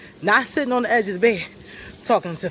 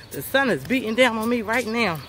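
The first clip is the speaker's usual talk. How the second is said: ordinary talk, nothing marked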